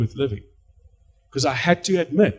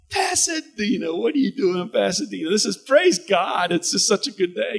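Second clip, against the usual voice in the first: gushing churchgoer; 6 semitones higher than usual. Retold or imitated. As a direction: rave about the church screening. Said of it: "super excited".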